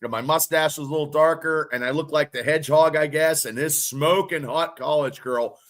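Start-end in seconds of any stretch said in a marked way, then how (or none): none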